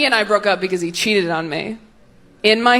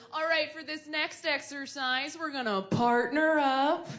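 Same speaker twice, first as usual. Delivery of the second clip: teacher voice; 5 semitones higher, 12 dB quieter than usual. Retold or imitated. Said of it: "That phrase is".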